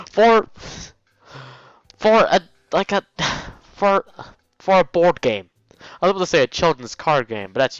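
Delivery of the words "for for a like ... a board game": high and loud, stammering in disbelief; exasperated disbelief. Acted. Hisao protesting to Misha about running for a board game, high and loud. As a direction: sputter at the absurdity.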